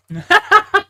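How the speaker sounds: shrill burst of laughter; helpless high-pitched laugh; high squeal laugh. Real, not acted. Laughs at own mistake.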